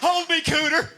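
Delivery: high, loud hammed-up anguish; mock distraught plea. Truck owner begging his buddy Cooter, hammed-up wail. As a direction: wail it like a grieving friend.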